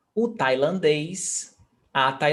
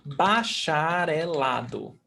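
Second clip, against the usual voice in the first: "bacharelado" drawn out syllable by syllable; slow, careful "bacharelado"; slow model word; slower than usual. Shown as a demonstration. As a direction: stretch out "ba-cha-re-la-do" for learners. Teacher models a new word.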